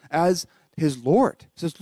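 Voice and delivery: ordinary delivery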